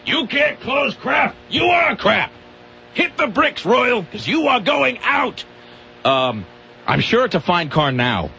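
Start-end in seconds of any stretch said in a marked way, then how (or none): 0.0-5.5 high, slow, furious shouting boss; angry boss yell. Retold or imitated. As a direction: bellow it down at a failed salesman. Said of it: "they told me I couldn't close".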